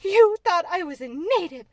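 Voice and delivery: put-on humiliated girl raging at the maid; raging accusation. A narrator's reading. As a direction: hammer it as an accusation. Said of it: "control her rage and humiliation".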